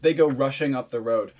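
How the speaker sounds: normal talking voice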